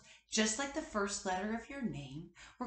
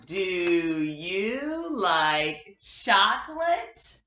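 slow, louder, drawn-out Do you like chocolate; dramatic question; 8 dB louder, much slower than usual. Shown as a demonstration. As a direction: stretch the question out for the class. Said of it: "here's the question".

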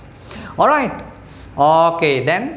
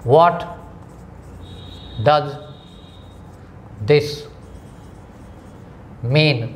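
each word split by pauses; 3 dB quieter, much slower than usual. Shown as a demonstration. Teacher dictating the question one word at a time. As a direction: pause after every word. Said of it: "Write it।".

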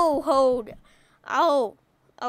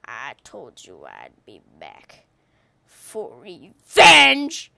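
mock villain; 13 semitones lower, 13 dB louder, much slower than usual. Villain line at enemy player.

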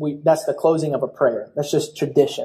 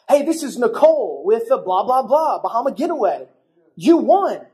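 higher, singsong sales patter; perky prize caller; 4 semitones higher than usual. Retold or imitated. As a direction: announce a prize like a chirpy telemarketer.

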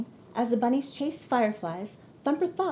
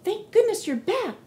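much higher, slow and louder; relieved cry; 12 semitones higher, 6 dB louder than usual. Acted. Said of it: "cried Tessie".

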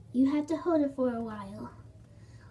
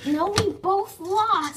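indignant protest; 4 semitones higher, 6 dB louder, slower than usual. Real, not acted. Arguing over who won.

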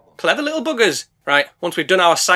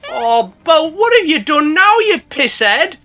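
much higher, slow exasperated scold; 10 semitones higher, 5 dB louder, slower than usual. Acted. Dick tells off his drunk boat, high slow scolding. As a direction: tut at the drunk.

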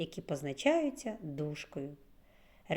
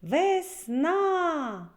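"весна" stretched high and loud, на held long; sing-out "весна" to find the stressed на; calling the word out; 10 semitones higher, 5 dB louder than usual. Shown as a demonstration. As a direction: call out "весна" with a long high second syllable. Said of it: "Промовимо разом слово".

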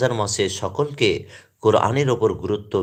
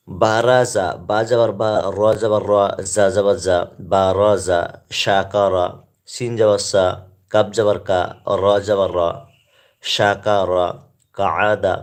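spelling recitation; 2 semitones lower, slower than usual. Shown as a demonstration. Teacher spelling Arabic words aloud to viewers, rhythmic drill. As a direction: chant each syllable crisply so learners can follow. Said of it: "পাঠ করছি, আরবি উচ্চারণগুলি বানান করে পড়ছি".

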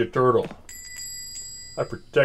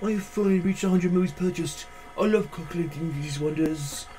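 odd customer voice; 3 semitones higher, 5 dB quieter than usual. Acted.

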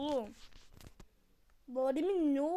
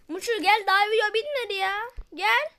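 loud call across the room; calling out loudly; 6 semitones higher, 9 dB louder than usual. Real, not acted. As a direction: shout for him to come back. Calling friend back.

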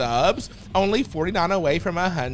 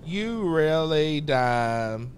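slow drawn taunt; 3 semitones lower, much slower than usual. Acted. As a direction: drag each word out slowly.

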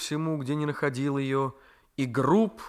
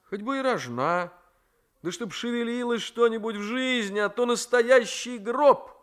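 much higher, rising to a louder cry of boredom; a bored nephew flaring up; exasperated young man; 9 semitones higher, 3 dB louder than usual. Acted. Raisky in despair.

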